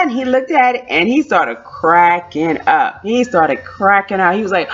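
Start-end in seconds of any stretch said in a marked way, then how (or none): none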